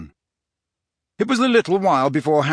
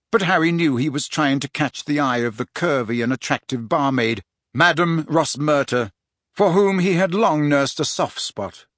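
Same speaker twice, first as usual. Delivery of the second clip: usual speaking voice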